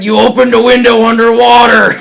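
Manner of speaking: loud, hammed-up pirate captain's yell; scolding shout. Captain scolding the crewmate who flooded the ship, scolding shout. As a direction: holler it at a foolish crewmate.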